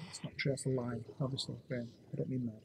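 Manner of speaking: very soft, low retraction; quiet aside. Real, not acted. Host taking back his rant to Ben, quiet aside. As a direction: drop right down, almost under your breath.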